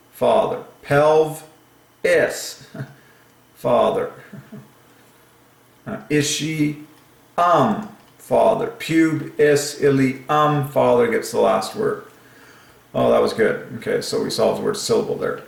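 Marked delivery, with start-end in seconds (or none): 0.8-2.7 "Pelv", pause, high "is"; syllable by syllable. Shown as a demonstration. Splitting pelvis into its syllables. 6.1-7.9 "ishi", pause, then a high "um"; last syllable split off. Shown as a demonstration. 8.8-10.7 "Pube, is, ili, um" in raised, even beats; syllable by syllable. Shown as a demonstration. Running through the split syllables.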